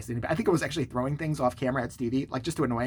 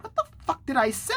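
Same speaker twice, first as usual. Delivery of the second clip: high-pitched, incredulous question; mock bafflement; 9 semitones higher than usual. Recalling own confusion over the comment, acted bafflement.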